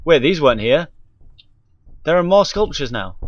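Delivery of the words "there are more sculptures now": normal talking voice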